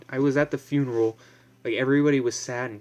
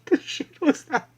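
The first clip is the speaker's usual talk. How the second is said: mock squeaky voice; 5 dB louder than usual. Mock high voice continues.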